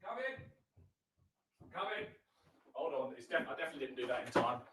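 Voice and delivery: distant voice from off mic; far off. Real, not acted. Host calling from downstairs after the break, far off. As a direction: call from another room, very faint.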